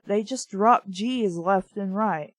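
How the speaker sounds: normal talking voice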